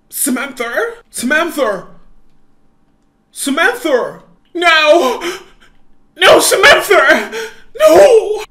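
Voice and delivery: loud, rising cries of grief; anguished shouting. Acted. Finding sister dead.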